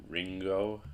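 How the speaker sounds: Ringo with ng then hard g; Ringo, the g released after ng; ng-g example. Shown as a demonstration. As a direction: say Ringo clearly as "RING-go". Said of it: "It's kinda like the name".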